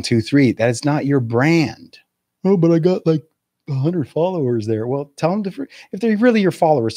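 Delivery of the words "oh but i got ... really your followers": mock whiny objection. Imitating objecting social media user.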